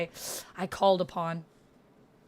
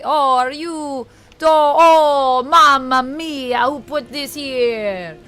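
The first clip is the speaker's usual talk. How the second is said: loud, drawn-out mock Italian outcry; exasperated gamer in a put-on Italian accent; exclaiming Italian accent; 4 semitones higher, 7 dB louder, slower than usual. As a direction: wail it slowly in a hammed-up Italian accent. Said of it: "I think we're done with Italian".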